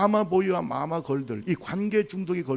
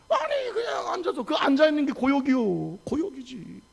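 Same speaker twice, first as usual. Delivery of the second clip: high-pitched comic whine; mock misery; 8 semitones higher than usual. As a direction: groan about the ordeal of sitting, pitched up. Acting the misery of sitting through service. (고역이라며 앓는 소리로 투덜대기)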